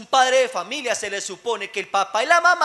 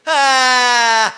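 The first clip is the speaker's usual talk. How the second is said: loud, drawn-out bawl; imitated child's yell; 9 dB louder than usual. Preacher mimics a child yelling at parents, long loud bawl. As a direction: bawl like a child having a tantrum. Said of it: "Gritan a los papás, los manotean".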